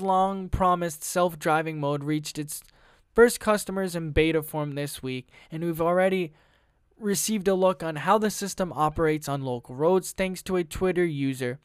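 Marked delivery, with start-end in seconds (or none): none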